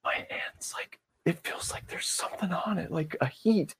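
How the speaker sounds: breathy, stunned hush; awestruck whisper. Retold or imitated. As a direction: whisper it as if amazed, imitating him. Plays the awestruck healed man.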